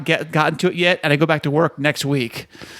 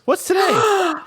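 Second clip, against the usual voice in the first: coy teasing; 12 semitones higher, slower than usual. Acted. Playful question about own birthday.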